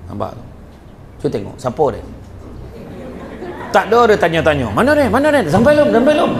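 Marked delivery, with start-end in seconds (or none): 4.7-6.3 high, eager repeated questions; eager asking. Retold or imitated. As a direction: ask as if impatiently awaiting the guest. Host asking eagerly for guest.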